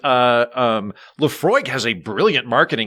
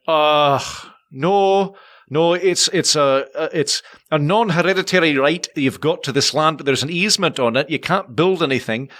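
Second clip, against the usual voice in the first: hesitant Scottish phone clerk, pitched up a little; Scottish accent; 3 semitones higher than usual. Retold or imitated. Distillery man answers a caller's drilling question, hesitant Scottish accent. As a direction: explain reluctantly in a Scottish accent. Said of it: "your Scottish accent was very well done".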